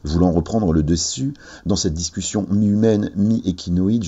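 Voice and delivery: ordinary delivery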